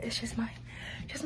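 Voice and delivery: hushed whisper. Real, not acted. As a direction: whisper it to yourself. Embarrassed aside.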